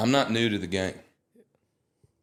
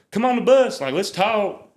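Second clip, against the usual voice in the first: much higher, louder, friendly invitation; 13 semitones higher, 7 dB louder than usual. Retold or imitated. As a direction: call them onto the bus like a cheerful country star. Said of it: "He's like".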